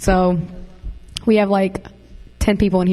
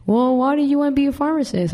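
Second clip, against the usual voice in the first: high-pitched mock questioning professor; imitating professor; 6 semitones higher than usual. Former student imitates professor questioning her career plan, high mock query. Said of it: "he was like".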